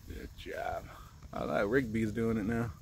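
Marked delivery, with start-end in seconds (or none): 0.1-0.8 whispered praise; whispering. Real, not acted. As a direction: whisper it softly. Unvoiced praise.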